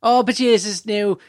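lightly hammed Irish accent; quick Irish accent bit. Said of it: "I can do an Irish accent".

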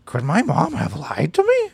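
high, frantic dog voice. Acted. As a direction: panicky silly dog pleading. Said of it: "a little more frantic".

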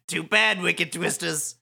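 high, loud, swooping taunt; gloating villain. Acted. Suzukichi taunting the Wicked Twisters, gloating. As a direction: crow it theatrically.

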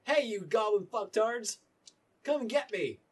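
high, loud, slow taunting yell; captain shouting a dare at the goblins; Captain Bailey taunting. Acted. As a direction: bellow it to bait the goblins. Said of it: "you hear Captain Bailey say something like".

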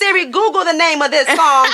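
savage mode. Retold or imitated. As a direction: taunt him by cranking the music. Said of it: "I was such in my savage mode".

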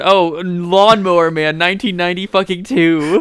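excited recognition. Real, not acted. Cohost suddenly remembering the movie, excited. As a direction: drag out Lawnmower Man as it dawns.

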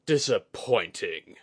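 slow, drawn-out disgruntled growl. Acted. Commander let down by a weak enemy, growling. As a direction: growl it slowly in disappointment. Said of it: "Etsuji growled".

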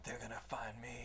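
low, hushed and ominous; mock-dramatic whisper of doom; grim vow. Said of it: "He's like".

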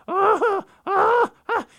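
pushing with all his might; strained high heaving ahs; straining grunt. Acted. Raven pulling heavy salmon.

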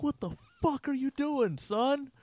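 high, slow yelling coach; furious coach yell. Retold or imitated. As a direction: blow up at the player from the sideline. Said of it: "had a fucking fit".

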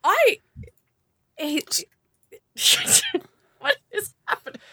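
high, cry-like wail of "what is happening"; squealing disgust. Real, not acted. Reacting to a bizarre character skin, high whimpering wail. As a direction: whimper in mock horror.

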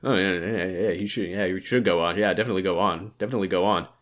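soft, low crowd murmur; murmured approval. Pretend. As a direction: mutter agreement like a crowd. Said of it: "most of the room murmured approvingly".

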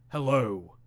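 low booming cinema announcer hello; a hint of a deep movie-theater announcer. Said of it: "this booming voice go".

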